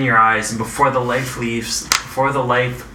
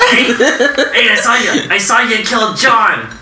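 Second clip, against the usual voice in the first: mobster voice; 8 semitones higher than usual. Acted. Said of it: "sound like a, a mobster?".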